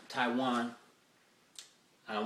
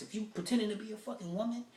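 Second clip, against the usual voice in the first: ordinary talk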